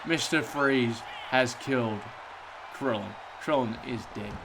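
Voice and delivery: low, soft somber commentary; wrestling commentator. Acted. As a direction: mourn the fallen wrestler softly. Mourns Krillin's in-game death.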